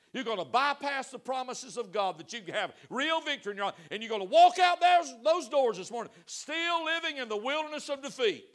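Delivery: loud, high preacher's warning; preaching at full force. Real, not acted. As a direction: thunder it at the pews. Peak of sermon warning.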